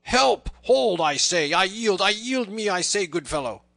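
high, loud, urgent yielding; roared plea. A narrator's reading. Beaten Little John surrenders to the tanner, roaring. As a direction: yell for mercy as blows fall. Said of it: "roared Little John".

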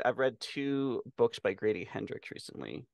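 normal talking voice

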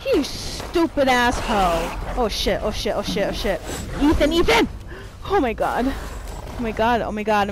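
loud panicked swearing under attack; scared horror game streamer. Real, not acted. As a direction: panic and shout through it.

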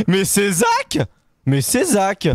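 high, slow delighted cry; excited recognition. Real, not acted. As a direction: greet him like a surprise. Reconnaît Zach en riant. (exclamation ravie)

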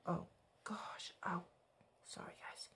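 whispered aside. Real, not acted. Small mishap aside.

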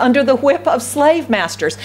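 ordinary delivery

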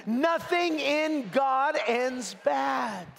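slow, high, shouted with conviction. Shown as a demonstration. Preacher calling the church to repeat it, shouted with conviction. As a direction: shout it like you believe it. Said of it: "Now say it with conviction".

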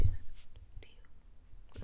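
breathless unvoiced gasp-word; whispered shock. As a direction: mouth it in alarm. Shocked reaction.

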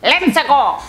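loud video game hero cheer; swooping, loud character cry; Mario. Acted. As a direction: launch it like a game catchphrase.

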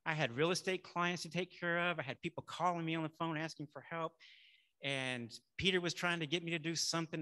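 ordinary delivery

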